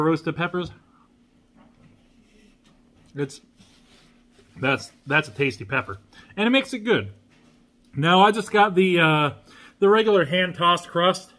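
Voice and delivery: talking between bites; chewing while talking. Real, not acted. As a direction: mouth partly full. Seen 1: Ate pepper at -3, jaw chewing while talking, napkin wipe.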